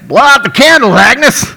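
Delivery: high, loud bellow of a gruff cook; a boisterous diner owner hollering; excited shout. Acted. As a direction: yell it across the diner, pushing her on.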